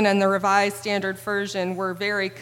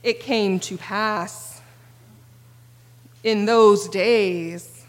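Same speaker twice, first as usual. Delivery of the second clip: solemn King James reading; much slower than usual. Retold or imitated. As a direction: intone it like an old Bible. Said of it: "This is how it would go in the King James Version".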